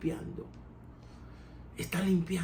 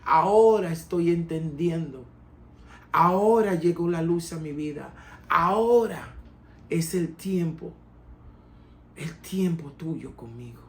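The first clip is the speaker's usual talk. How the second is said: louder, higher preaching at full force; fervent preaching; 3 semitones higher, 6 dB louder than usual. Acted. A preacher proclaiming the father's welcome, fervent. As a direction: preach it with fire to the congregation.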